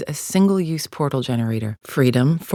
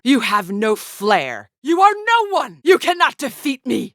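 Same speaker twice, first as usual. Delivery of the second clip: raised voice climbing to a high shout; desperate battle rage, building higher; escalating fury; 10 semitones higher, 5 dB louder than usual. Acted. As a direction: hurl insults at the opponent. Insulting the opponent in battle.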